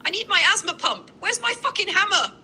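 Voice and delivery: high, panicked shrieking rant; a meltdown shouted from the store aisle; frantic panic. Pretend. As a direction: spiral into hysteria, voice way up. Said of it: "and that fast you lost it?".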